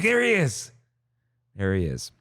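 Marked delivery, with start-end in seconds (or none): 0.0-0.7 high-pitched excited outburst; excited spotting. Retold or imitated. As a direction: re-enact spotting him, voice jumping way up. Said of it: "I'm like".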